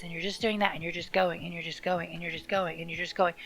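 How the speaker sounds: fast, monotone going-through-the-motions list; fast, flat, repetitive droning; droning repetition. Shown as a demonstration. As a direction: rattle it off like a dull routine. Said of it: "Do you hear that repetitiveness in my voice".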